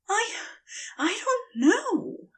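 panting between words; breathless high Aziraphale; out of breath. A narrator's reading. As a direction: gasp the words out, winded. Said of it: "he said between heaving breaths".

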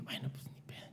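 breathy whispered giving up; whispered resignation. Retold or imitated. Retelling giving up on the casting, whispered.

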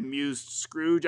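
plain reading voice

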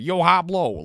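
loud, high Spanish in sausage accent; hammed-up Chicago sausage accent on Spanish. Shown as a demonstration. Showing how his Spanish sounds, exaggerated sausage accent. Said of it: "with my fucking sausage accent".

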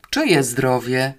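ordinary delivery, nothing marked

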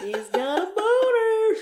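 high, loud, cracking up; laughing jeer. Real, not acted. Teasing the co-host with a crude joke, laughing. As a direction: crow it loudly through laughter.

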